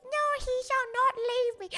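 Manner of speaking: high, hammed-up declaration; mock dramatic mother. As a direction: play the scheming mother over the top. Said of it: "she was like".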